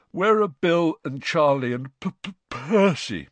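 stammering into a big stretched-out yawn. A narrator's reading. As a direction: let a yawn take over the last name. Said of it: "failing to stifle a huge yawn".

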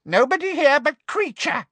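very high, gloating elf voice; high creature voice, delighted; gleeful house elf. A narrator's reading. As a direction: crow it with glee. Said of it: "said the elf gleefully".

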